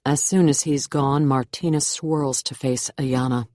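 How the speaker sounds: ordinary delivery, nothing marked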